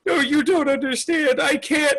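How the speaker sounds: high, distressed mock plea; hammed-up helpless protest; mock pleading. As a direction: plead high-pitched, like a melodramatic breakup. Acted pleading in breakup.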